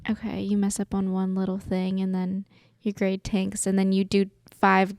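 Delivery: usual speaking voice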